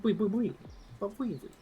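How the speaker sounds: cute Eevee voice. Pretend. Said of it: "It's fun to be an Eevee".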